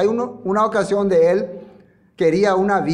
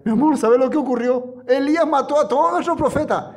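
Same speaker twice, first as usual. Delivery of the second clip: high, tattling husband voice; whiny Acab; 9 semitones higher than usual. Acted. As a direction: run to your wife to tattle. Acab voiced telling his wife. (Acab le cuenta a su esposa, voz aguda y quejosa)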